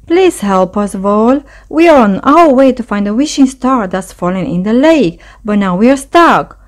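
usual speaking voice

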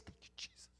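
breathy whispered thanks; whispered prayer. Real, not acted. Preacher whispering thanks to Jesus, hushed.

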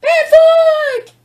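calling the dog's name high and loud; bright excited call to the dog. Real, not acted. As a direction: sing out his name to get his attention.

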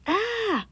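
high startled scream; startled yell. Acted. Son coming home surprised by dad in his room, high shriek.

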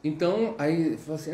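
ordinary talk

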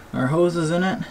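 normal talking voice

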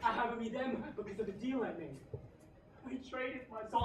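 ordinary talk, nothing marked

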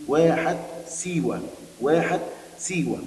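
واحة سيوة said slowly, each word given weight; slow emphatic repeat. Shown as a demonstration. Teacher drilling the oasis name with pupils, slow and deliberate. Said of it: "اسم الواحة واحة إيه؟".

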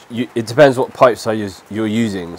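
normal talking voice

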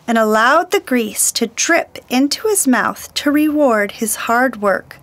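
ordinary delivery

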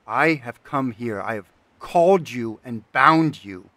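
loud, insistent commanding no's; furious refusal. Acted. Artisan commanding the bound spirit, loud and furious. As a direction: slam down the demand.

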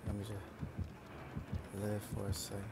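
slow, soft, stretched-out plea; drawn-out plea. Real, not acted. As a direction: beg for a moment to breathe. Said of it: "I can't even speak right now".

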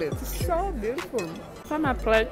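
ordinary delivery

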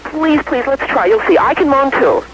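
high-pitched, acted begging; pleading. Wife begging her husband to let her try, pleading. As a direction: plead eagerly for a chance.

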